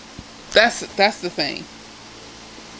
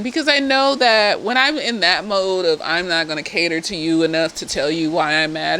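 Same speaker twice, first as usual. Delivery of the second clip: ordinary delivery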